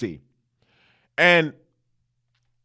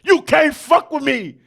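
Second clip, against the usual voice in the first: high, loud cocky taunt; mock Nick Saban; 10 semitones higher, 3 dB louder than usual. Coach Saban taunting Kirby Smart, cocky shout. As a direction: shout a swaggering boast at the loser. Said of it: "Nick Saban like".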